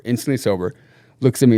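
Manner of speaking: ordinary delivery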